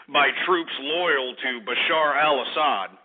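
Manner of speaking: ordinary talk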